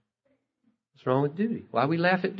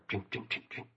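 whispered drinking; 3 semitones lower, 15 dB quieter than usual. Acted. Puppies lapping water.

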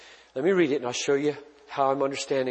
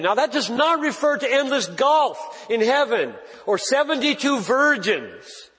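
higher, louder, slowed-down pulpit delivery; preaching at full force; 7 semitones higher, 3 dB louder than usual. Acted. Pastor pressing the crowd on verse sixteen, preaching at full force. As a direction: drive each phrase home to the congregation.

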